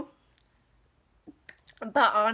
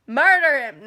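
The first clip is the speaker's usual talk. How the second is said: loud burst of command; angry outburst; 3 semitones higher, 10 dB louder than usual. Real, not acted. Venting to viewers about Roy, angry shout. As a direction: shout it out in a flash of anger.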